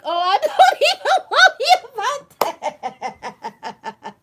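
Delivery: laughing through his reply; laughing quote. Retold or imitated. Host retelling his reply, laughing high and slow. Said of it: "He was like".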